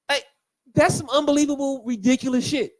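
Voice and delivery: ordinary talk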